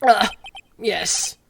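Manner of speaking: slow, lightly voiced old lawyer; a hint of a pompous old man; slow, self-important old attorney. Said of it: "what should his voice be?".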